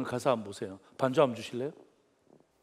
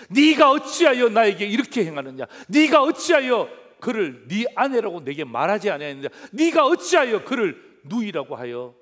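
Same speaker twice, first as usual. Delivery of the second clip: rebuke; 6 semitones higher, 13 dB louder than usual. Acted. Pharaoh rebuking Abraham.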